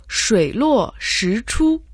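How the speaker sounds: slow Mandarin "шуй луо ши чу", each syllable toned; Chinese pronunciation. Shown as a demonstration. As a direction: say "шуй луо ши чу" slowly as a Chinese model phrase. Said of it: "По-китайски оно звучит как".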